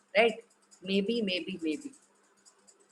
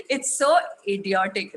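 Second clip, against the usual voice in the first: normal talking voice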